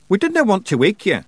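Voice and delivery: high, loud dialect voice; Rob Anybody. Acted. Rob explains to Tiffany why they woke her, high dialect voice. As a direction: pitch it well above the narration, in dialect.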